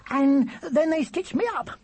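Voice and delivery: put-on high, proud child's boast. A narrator's reading. Charlie telling Will about his stitches, proudly. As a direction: crow it in a high little voice. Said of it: "said Charlie proudly".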